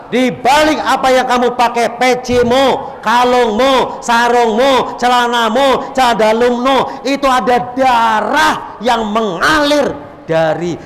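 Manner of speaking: high, loud sermon build; fiery preaching. Acted. Preacher urging students to honor their fathers' sacrifice, fiery. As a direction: hammer each item home with rising fervor.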